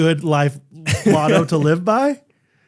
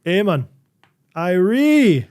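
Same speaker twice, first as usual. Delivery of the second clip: mock Jamaican, pitched higher and drawn out; Jamaican accent; 4 semitones higher, 3 dB louder, much slower than usual. Friend testing accents on a dog, playful Jamaican accent. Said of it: "how much of an accent".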